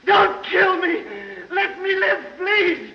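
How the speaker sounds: high, shrill, far-off mock pleading; Renfield begging. Renfield begging Dracula for mercy, high mock shouted pleading. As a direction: beg for your life, hammed-up. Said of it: "He says".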